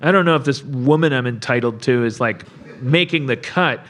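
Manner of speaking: low, bored mumble; mock bored hero. Host imitating Santa judging his date, low and bored. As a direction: sound unimpressed and low. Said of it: "he's like".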